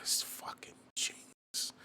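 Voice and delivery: slow hushed whisper. Retold or imitated. Telling himself his trick is genius, slow whisper. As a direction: breathe it out slowly, no voice. Whispered self-praise quote.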